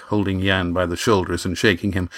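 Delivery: usual speaking voice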